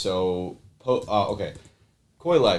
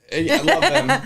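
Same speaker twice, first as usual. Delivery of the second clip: laughing; 7 dB louder than usual. Real, not acted. Laughs while answering.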